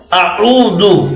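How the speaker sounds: اعوذ with open aa then rounded oo; correct Aoozu. Shown as a demonstration. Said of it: "آ میں منہ کو کھولنا ہے". (اعوذ میں آ پر منہ کھولیں، او پر ہونٹ گول)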